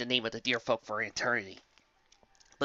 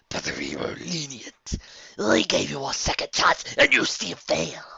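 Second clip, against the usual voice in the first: menacing dark whisper; 3 semitones higher, 5 dB louder than usual. Acted. Dark entity scolds servant.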